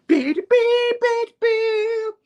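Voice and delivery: very high, loud electronic beeps; high-pitched mimicked beeper; imitated beeping. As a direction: squeal beeps like an alarm going off. Imitating beeper while retelling comic.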